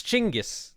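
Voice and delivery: "Chingis" with an opening CH, as in chin; Mongolian-style pronunciation. Shown as a demonstration. Player showing the Mongolian name, "Chingis" starting with CH. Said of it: "In Mongolian, his name is".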